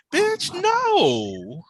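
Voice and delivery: high, loud put-on voice of disbelief; mock outrage. Ranting about the boiled shrimp, in a high mock-outraged squeal. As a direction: squeal it like a diva refusing her plate.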